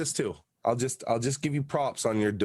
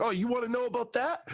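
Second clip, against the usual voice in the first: mocking challenge; 12 semitones higher, faster than usual. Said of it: "He's like".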